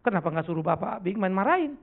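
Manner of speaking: usual speaking voice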